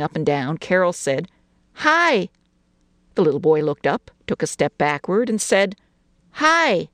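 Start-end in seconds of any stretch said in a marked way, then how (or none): 1.7-2.3 put-on bright, high child's hello; a small girl calling hi; little girl voice. A narrator's reading. Girl greets new boy. 6.3-6.9 put-on high, small child's hi; a shy little boy answering. A narrator's reading.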